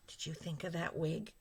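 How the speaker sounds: ordinary talk, nothing marked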